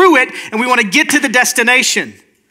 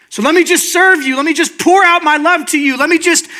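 higher, insistent offers; over-eager servant; 6 semitones higher than usual. Acted. Servant begging to pour out love on everyone, over-eager. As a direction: plead to serve, pitched up.

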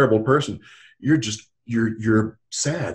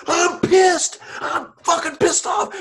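high, loud angry outburst; an angry teenager admitting he's pissed off; angry teen; 4 dB louder, slower than usual. Retold or imitated. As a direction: blurt out the rage. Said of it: "he says".

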